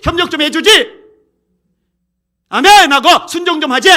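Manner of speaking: high, very loud, mounting list of complaints; exasperated outburst. Acted. Preacher on Moses' people who saw miracles yet won't obey, exasperated shouting.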